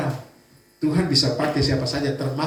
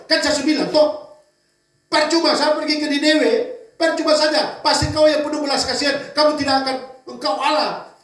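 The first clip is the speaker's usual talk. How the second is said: angry complaint; 11 semitones higher than usual. Acted. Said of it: "dia marah dengan Tuhan".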